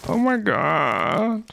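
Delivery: high, slow groan of disbelief; drawn-out dismay. Real, not acted. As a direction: stretch it out in exasperation. Dismayed at full inventory.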